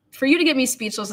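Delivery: ordinary talk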